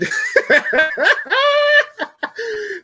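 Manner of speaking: long, high amused cackle; laughing. Real, not acted. Breaking up mid-sentence over Saints fans, high laughter.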